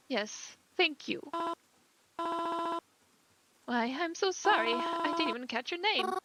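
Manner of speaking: a mother startled at her daughter's funeral; grieving mother. Acted. Grieving mother greets a stranger at the funeral, gently.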